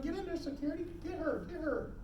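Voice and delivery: high, hushed urgent imitation; mock panicked staff. Staff backed up from a screaming patient, mock panic. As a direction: softly mimic nervous staff calling for help.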